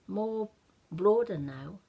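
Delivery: usual speaking voice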